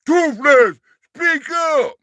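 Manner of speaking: mock yell. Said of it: "He said".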